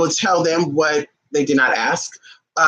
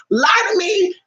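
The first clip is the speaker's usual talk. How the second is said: excited outburst; 9 semitones higher, slower than usual. Real, not acted. Excited rant to viewers.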